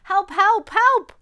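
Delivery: loud, high urgent cries; little truck calling out in panic; crying for help. A narrator's reading. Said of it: "cried the little blue truck".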